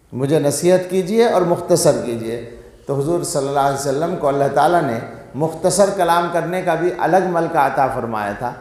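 ordinary delivery

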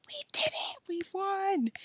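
very high, squealed celebration; gleeful. A narrator's reading. Alan and Joe wrestling in the back seat, gleeful. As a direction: squeal in triumph. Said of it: "Alan and Joe wrestled gleefully".

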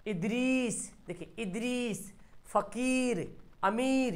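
इदरीस, फ़कीर, अमीर with the ee held; stretched long ee. Shown as a demonstration. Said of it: "याय को खींच कर पढ़ा जाता है". (इदरीस, फ़कीर, अमीर में ई खींचकर)

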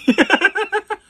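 high squealing burst of laughter, loud; giddy laugh. Real, not acted. Laughing loudly at her own wish for money and kisses.

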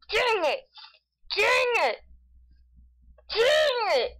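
rising high frustrated cries. Real, not acted. Frustration, each higher.

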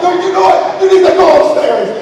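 high, loud angry order. Retold or imitated. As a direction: send her upstairs, furious.

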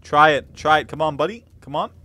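loud urging. Real, not acted. Urging a friend loudly.

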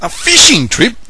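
high, loud thrilled question; a boy's high, loud excited question; excited cry. Acted. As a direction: burst out in delight. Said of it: "cried Nathan".

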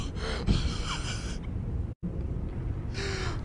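airy high laughter; breathless laugh. Real, not acted. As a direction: wheeze out a laugh.

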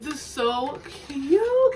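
usual speaking voice

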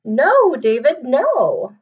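loud, slow, drawn-out scolding; a fed-up mother scolds; exasperated mom. Acted. Mom scolding David in the story.

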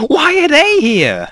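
high, slow, startled question; alarmed question. Real, not acted. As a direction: ask it high and drawn out in alarm. Alarmed at the Daleks.

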